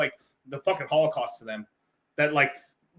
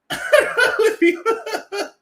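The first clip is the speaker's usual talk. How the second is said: loud high-pitched laughter; a loud, high burst of laughter; 7 dB louder than usual. Real, not acted. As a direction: crack up at your own joke. Laughing at own joke.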